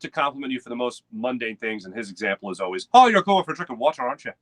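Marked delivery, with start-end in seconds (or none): 2.9-4.3 attempted Irish accent. Irish person praising his friend, in a hammy Irish accent. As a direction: belt out a bad Irish accent. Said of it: "without attempting the voice".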